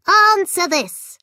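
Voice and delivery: loud, high menacing command; Goblin King. A narrator's reading. Goblin King demanding an answer from the children. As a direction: order them like a cruel king.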